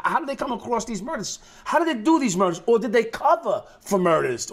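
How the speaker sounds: normal talking voice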